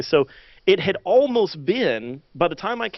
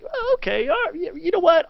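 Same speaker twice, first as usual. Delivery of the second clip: much higher, flustered okay; flustered giving in; 12 semitones higher than usual. Retold or imitated. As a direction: cave in, startled and rattled. Caving in to wife.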